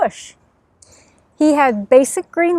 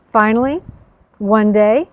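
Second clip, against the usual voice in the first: ordinary delivery, nothing marked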